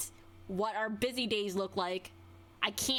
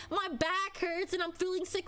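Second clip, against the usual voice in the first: higher, sneering quote of the excuse; mocking whine; 7 semitones higher than usual. Venting about a coworker, mocking her sick excuse. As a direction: mimic the lame excuse with scorn. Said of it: "Why do the whole".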